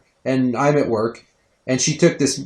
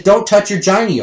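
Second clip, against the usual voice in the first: higher, louder warning; exasperated parent's raised voice; parental scolding; 9 semitones higher, 6 dB louder than usual. Retold or imitated. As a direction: firmly tell a toddler off.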